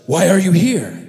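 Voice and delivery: mock-stern questioning, pushed loud; stern governor. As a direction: demand it like a suspicious official. Joseph as governor interrogates brothers.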